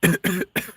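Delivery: choking cough on a bug. Real, not acted. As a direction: cough hard to clear it. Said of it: "A bug just flew in my mouth".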